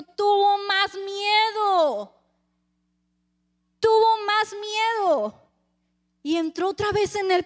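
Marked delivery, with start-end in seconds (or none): 0.0-5.4 slow, drawn out and louder; dramatic emphasis. Acted. Preacher stressing Pilate's fear to the congregation, slow emphatic repeat. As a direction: lean hard on every word, slowly. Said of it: "dramatic repeated emphasis".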